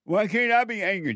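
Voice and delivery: high, whiny mock self-justification; mocking the excuse. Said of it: "But you tell yourself".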